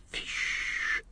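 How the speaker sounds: hushed, hissing whisper; soft voiceless hiss; whispered into ear. A narrator's reading. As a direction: whisper it close to someone's ear. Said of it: "close to his ear".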